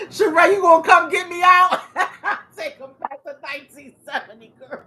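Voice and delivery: high-pitched talk through real laughter; words breaking up into giggles; laughing hard. Real, not acted. Speaker joking to Sheree about getting bailed out, laughing hard. Cracking up laughing at own joke.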